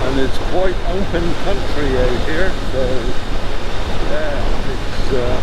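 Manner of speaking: muffled through a helmet mic; riding with wind noise; inside a helmet. Real, not acted. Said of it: "I hope there's not too much wind noise going into the microphone".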